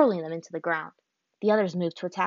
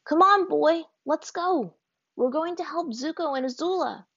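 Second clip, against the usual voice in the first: eager call; 9 semitones higher than usual. A narrator's reading. Aang to his bison, high and eager. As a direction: urge the bison along.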